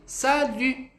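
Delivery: "Salut" high, lips rounded on the u; final drill of "Salut"; model salut. Shown as a demonstration. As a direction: practise "Salut" with pursed lips. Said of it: "Pratiquem aí".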